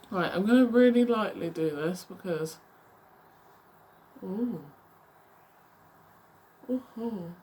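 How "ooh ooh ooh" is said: hiccuping mid-word; interrupted by hiccups. Real, not acted. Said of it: "I've got hiccups".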